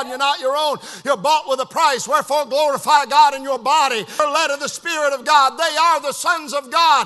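loud, driving sermon delivery; full-force preaching. Real, not acted. Preacher to congregation about the Holy Spirit, full-force preaching. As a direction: preach it hard, building to a shout.

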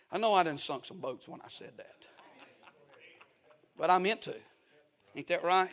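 whispered aside. Real, not acted.

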